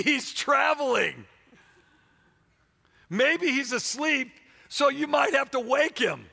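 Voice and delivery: high, drawn-out, mocking taunts; making fun. Elijah ridiculing the prophets' silent god, mocking taunt. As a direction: tease them loudly, stretching each suggestion slowly. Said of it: "Elijah began to make fun of them".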